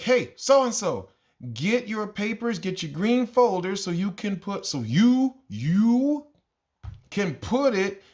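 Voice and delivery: put-on over-enunciate for a spaced-out kid; slow teacher callout. Teacher calling a daydreaming kid, slow and high.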